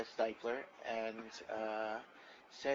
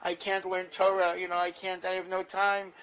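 loud, high, exasperated yell building; a deaf old sage yelling at a visitor; 7 semitones higher, 13 dB louder than usual. Retold or imitated. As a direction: shout the complaint, climbing to a bellow. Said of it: "And he yells at me".

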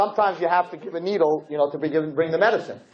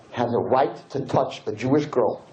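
ordinary delivery

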